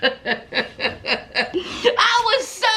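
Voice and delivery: stretched through laughter; slow laugh-crying. Real, not acted. Overjoyed for Travis, laughing slowly.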